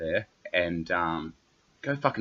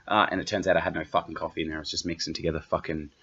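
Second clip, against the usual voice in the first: ordinary delivery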